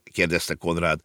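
usual speaking voice

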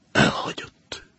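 a whispered, broken admission; whispered grief. Acted. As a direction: let the word die in a whisper. Banker says niece left him.

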